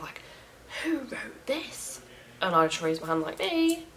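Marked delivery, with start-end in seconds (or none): 0.8-2.0 high, drawn-out hushed yell; mock shout. Roommate demanding who left the note, mock shout. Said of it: "she literally shouted". 3.4-3.9 high, small "me"; sheepish owning up. Retold or imitated. Answering the angry roommate about the note, high-voiced owning up.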